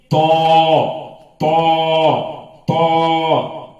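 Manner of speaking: "تو" stretched long with rounded lips; long, full, modelled "تو"; drawn-out letter. Shown as a demonstration. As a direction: hold each "تو" on a level tone. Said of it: "کھینچ کھینچ کر پڑھنا چاہیے".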